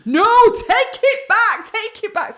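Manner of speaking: high, loud, drawn-out "no"; re-enacted exasperated yell; frantic shout. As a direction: shout a panicked order across the security queue. Frantically stopping mother at security scanner.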